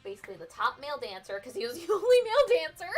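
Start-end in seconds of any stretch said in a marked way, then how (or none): 1.4-3.0 quick, higher and louder, laughing as the joke lands; laughing through it. Real, not acted. Laughing while telling friends the only male dancer. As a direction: giggle out the punchline fast.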